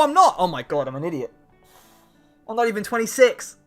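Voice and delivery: ordinary talk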